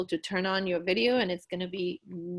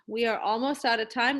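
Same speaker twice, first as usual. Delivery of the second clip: ordinary talk, nothing marked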